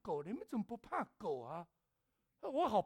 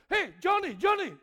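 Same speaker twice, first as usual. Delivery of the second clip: calling out; 6 semitones higher, 10 dB louder than usual. Retold or imitated. Neighbor calling out to dog.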